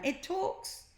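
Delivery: high, stunned; shocked. A narrator's reading. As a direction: cannot believe it. Jemima shocked.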